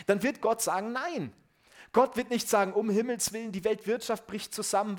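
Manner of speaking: höher und lauter predigen, mit Nachdruck; forceful preaching. Acted. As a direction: proclaim it to the congregation. Preaching climax, louder. (eindringliche, laute Predigt)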